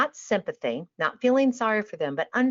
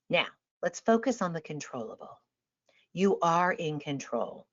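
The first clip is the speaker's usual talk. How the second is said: meditation voice; 5 dB quieter than usual. Shown as a demonstration. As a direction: ease them down as if opening a guided meditation. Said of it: "I'm gonna go into a meditation, uh, voice".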